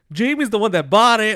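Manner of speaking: loud, high mock blurting; imitating tattling cousin. Cousin blurts out blame on teller.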